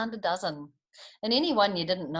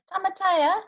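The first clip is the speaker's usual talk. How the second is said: much higher, loud call; 13 semitones higher, 8 dB louder than usual. A narrator's reading. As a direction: call your friend from afar. Ka Pai calling his friend.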